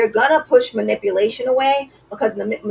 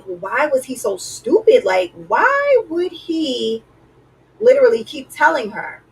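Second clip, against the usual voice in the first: incredulous mockery; 6 semitones higher, slower than usual. Everybody mocking Samson's foolishness.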